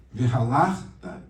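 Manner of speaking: ordinary delivery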